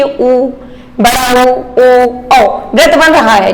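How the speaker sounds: "उ", "ओ", "औ" drawn out slowly with rounded lips; rounded vowel demo. Shown as a demonstration. Teacher repeating rounded vowels for the class, slowly. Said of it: "पहले मैं फिर से करवा रही हूँ".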